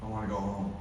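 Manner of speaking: very soft, fading voice; dying dad asking to go home; frail, failing father. Retold or imitated. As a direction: barely hold the words up.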